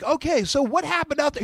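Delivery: high, bright mock interviewer; lightly voiced woman interviewer. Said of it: "interviewer is like".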